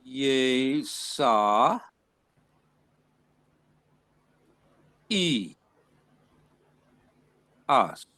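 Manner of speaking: Ye sa e as broken into slow separate syllables; slow syllable split. Shown as a demonstration. Teacher sounding out the syllables of the name slowly. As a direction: spell it out syllable by syllable. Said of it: "that's how it would sound".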